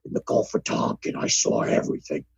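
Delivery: very low, gravelly-free deep rumble; Jesse Ventura impression. Playing Jesse Ventura for cohost, very low voice. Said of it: "advertisement for the episode as Jesse the Body".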